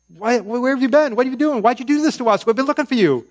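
high, loud, panicked mother scolding her son; a worried mom who finally found her missing boy; frantic mom. Acted. Mary finding Jesus after three days, frantic high scolding. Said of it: "Mary, she's a mom".

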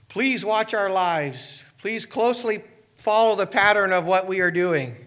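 slow and earnest, pitch lifted; slow pleading invitation. Pretend. Pastor imagining the church inviting the town, slow earnest plea. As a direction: plead slowly for them to watch. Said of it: "Imagine if we were to do that".

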